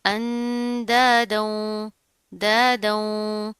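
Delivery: अंदादों very slowly, with long nasal hums; nasal ghunnah. Shown as a demonstration. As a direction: stretch the n and the closing sound of दादों. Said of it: "यहाँ पर भी हम गुन्ना करेंगे". (अंदादों, दादों में लंबा गुन्ना)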